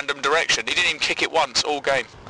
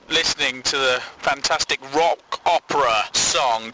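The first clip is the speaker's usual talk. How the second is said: usual speaking voice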